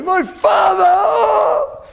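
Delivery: loud, high, drawn-out mock wail; hammed-up bawling, stretched right out; crying out. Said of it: "he started crying".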